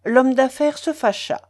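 usual speaking voice

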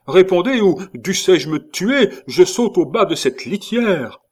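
high, loud, desperate shouting; shouted threat. A narrator's reading. A husband threatening to jump from his litter, shouted threat. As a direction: shout an ultimatum across the night. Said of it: "cria la voix".

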